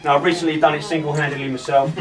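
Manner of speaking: usual speaking voice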